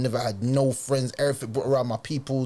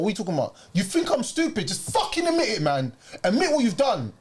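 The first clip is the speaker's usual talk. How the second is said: put-on panicked girlfriend; 8 semitones higher than usual. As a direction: voice climbing much higher in rising panic. Retelling girlfriend's panicked phone denial.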